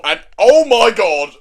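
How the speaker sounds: loud, high, drawn out; emphatic, slow-stretched outburst; exasperated exclamation. Real, not acted. Recapper reacts to the awful king, exclaiming.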